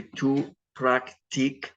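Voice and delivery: tú practicas broken before the final "as"; stem, then ending. Shown as a demonstration. Teacher showing how to say you practice, in separated parts. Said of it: "What ending".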